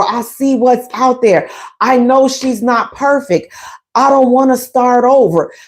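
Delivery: ordinary delivery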